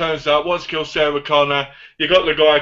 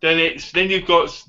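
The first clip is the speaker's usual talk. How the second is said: usual speaking voice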